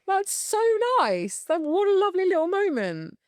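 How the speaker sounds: high-pitched gushing thanks; mock-touched, swooping up high; gushing delight. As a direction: act out being thrilled by a stranger's compliment. Acts delighted reply to a compliment.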